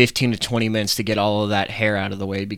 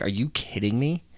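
inner disbelief; 6 dB quieter than usual. Retold or imitated. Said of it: "But in my mind".

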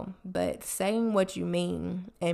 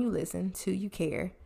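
usual speaking voice

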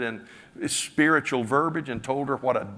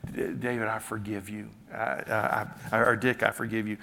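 soft, gentle voice of God, much quieter; tender forgiveness; 4 dB quieter than usual. Retold or imitated. The Lord forgives the praying father, tenderly and softly. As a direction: speak it tenderly, like comforting a child. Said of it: "The Lord tenderly spoke".

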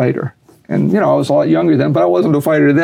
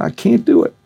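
put-on Southern kid; 8 semitones higher than usual. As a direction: imitate a Southern kid, voice pitched up. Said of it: "He's a Southern kid".

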